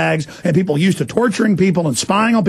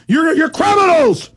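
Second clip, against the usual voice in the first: angry shout; 10 semitones higher than usual. Real, not acted. Ranting at spies.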